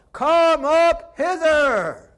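high, loud, very slow summons; booming drawn-out pulpit shout; heavenly shout. Acted. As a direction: stretch each word into a mighty call. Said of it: "descend from heaven with a shout".

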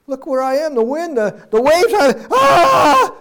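rising hammed-up panic ending in a laughing yelp; mock panic. Peter sinking in the waves, mock panicked cry. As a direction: play the sinking man's fright for laughs.

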